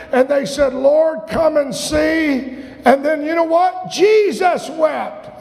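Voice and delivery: building pulpit intensity; impassioned scripture preaching. Real, not acted. Preaching the scripture with rising force.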